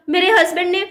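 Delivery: usual speaking voice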